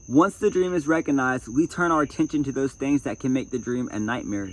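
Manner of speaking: projected reading from a self-help book; book reading aloud. Acted. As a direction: read it out firmly to an audience.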